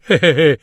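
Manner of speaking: short teasing laugh; mocking laugh. A narrator's reading. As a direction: laugh at the cobbler. (risata canzonatoria)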